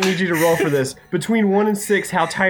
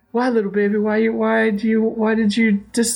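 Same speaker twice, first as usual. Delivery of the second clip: put-on baby talk; 6 semitones higher than usual. Cooing over rescued baby.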